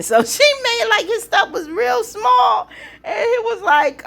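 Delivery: high, laughing through the words; can't hold the giggles while telling it. Real, not acted. Vlogger cracking up recapping the date, laughing.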